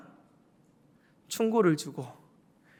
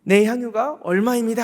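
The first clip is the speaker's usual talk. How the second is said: proud boast; 4 semitones higher, 7 dB louder than usual. Retold or imitated. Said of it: "하면서 떳떳하게 부을 수도".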